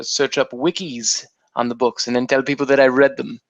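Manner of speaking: ordinary delivery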